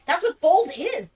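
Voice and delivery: "That's what bold is" hit high, loud and slow; emphatic. Real, not acted. Co-host defending bolded emails, emphatic.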